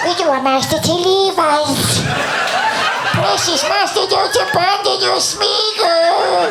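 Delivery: very high, pleading creature rasp; Gollum voice. Retold or imitated. Leading her date to his taxi as Gollum, high pleading. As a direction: beg like Gollum clinging to his master. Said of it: "as Gollum".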